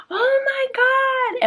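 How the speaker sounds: high, loud oh my God in alarm; shocked gasp. Retold or imitated. Owner gasping at her fallen puppy. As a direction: cry out in shock at the fall. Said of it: "I look and I'm like".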